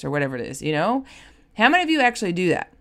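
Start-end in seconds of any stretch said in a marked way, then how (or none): none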